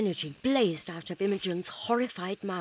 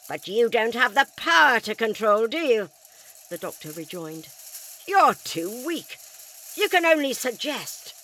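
defiant shout; 2 semitones higher, 7 dB louder than usual. Acted. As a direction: throw it back at the alien. Doctor defying alien.

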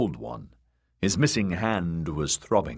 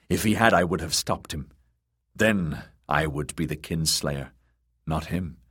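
ordinary delivery, nothing marked

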